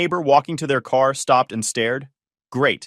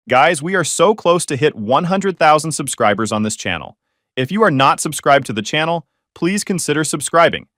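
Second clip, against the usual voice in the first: louder upbeat subscribe plea; channel outro; 3 semitones higher, 6 dB louder than usual. Real, not acted. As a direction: sell the subscribe ask with energy. Subscribe appeal.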